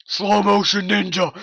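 ordinary delivery

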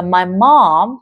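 "mom" with a wide open AH, jaw dropped. Shown as a demonstration. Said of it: "Âm này gọi là âm O hạ hàm". ("mom" âm O hạ hàm, mở miệng rộng)

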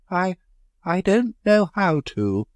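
high, slow halting answer; faltering. A narrator's reading. Leonard admitting he cannot undo it, faltering. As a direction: stammer it out scared. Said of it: "faltered Leonard".

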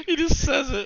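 high-pitched through real laughter; laughing. Real, not acted. Streamer amused by what the clip repeats, laughing. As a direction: barely get it out for laughing.

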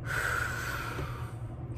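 trying not to cry on camera; holding back tears. Real, not acted. Vlogger to followers, fighting back tears. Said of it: "I don't wanna start crying".

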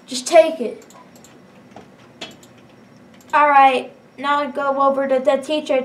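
normal talking voice

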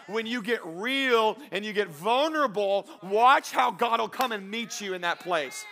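high, forceful preacher's voice; impassioned preaching. Real, not acted. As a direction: press the congregation with rising urgency.

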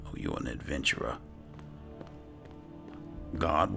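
usual speaking voice

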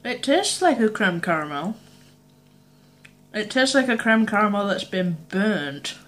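eating and speaking; chewing while talking. Real, not acted. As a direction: mouth partly full. Seen 1: Ate piece just before; lips and cheeks show chewing between words.

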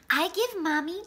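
plain reading voice

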